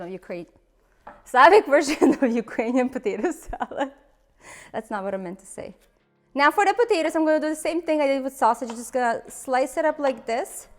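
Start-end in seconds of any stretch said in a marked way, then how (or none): none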